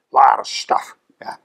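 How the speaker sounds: whispered aside "a lot of stuff". Shown as a demonstration. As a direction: breathe the repeat as a secret. Repeats phrase, second whispered.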